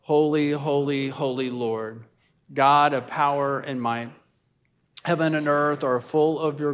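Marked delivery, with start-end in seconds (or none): none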